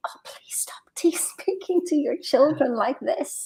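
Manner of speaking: slow, pleading whisper; hushed aside to herself. Retold or imitated. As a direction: whisper your silent plea to the mother. Inner plea to the shouting mother.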